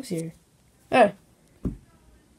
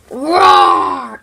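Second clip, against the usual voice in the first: dragon roar; 9 semitones lower, 8 dB louder than usual. Acted.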